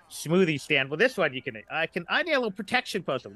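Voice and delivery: usual speaking voice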